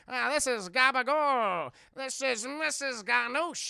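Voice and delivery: hammed-up thug drawl; tough-guy introductions. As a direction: drag out each name like a cartoon mobster. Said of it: "tough guys' names".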